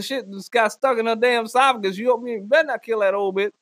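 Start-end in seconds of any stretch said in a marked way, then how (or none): none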